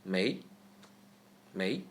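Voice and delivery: mei rising in pitch; second tone. Shown as a demonstration. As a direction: lift mei like a question. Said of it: "turn it into the second tone".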